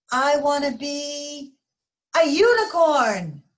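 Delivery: high, slow, thrilled declaration; excited child. Acted. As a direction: burst out with your big dream. George declares his dream.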